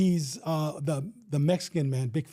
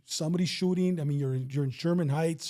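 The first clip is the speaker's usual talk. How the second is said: ordinary talk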